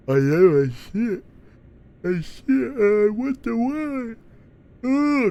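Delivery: high, stretched words mid-yawn; loud, drawn-out yawning speech; talking through a yawn. Real, not acted. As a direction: keep talking as the yawn takes over. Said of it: "Why does it make me yawn?".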